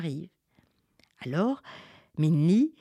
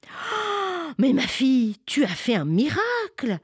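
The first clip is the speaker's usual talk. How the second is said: high breathy gasp, then loud wonder; amazed; 10 semitones higher, 4 dB louder than usual. Acted. Farmers amazed at the girl, high and loud. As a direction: marvel at the girl's miracle.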